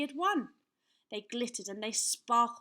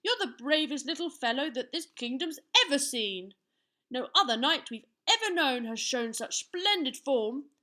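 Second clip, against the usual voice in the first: raised, louder royal praise; rejoicing; 4 semitones higher, 7 dB louder than usual. A narrator's reading. King and queen praise the little knight, rejoicing. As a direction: proclaim the praise joyfully. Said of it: "rejoiced the king and queen".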